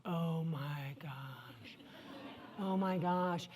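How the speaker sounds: hushed, drawn-out low groan of dismay; overwhelmed. Retold or imitated. Friend confiding over lunch about the new job, hushed dismay. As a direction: imitate a stunned friend, very soft and slow. Friend retells his shock in fetal position.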